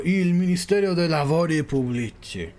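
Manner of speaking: "Pubblici" with an added ee ending; attempted Italian pronunciation. Shown as a demonstration. Streamer retrying the ministry's Italian name, attempted pronunciation. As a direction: say "Pubblici" as POO-blee-tee, carefully voweled. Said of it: "try and pronounce the Italian".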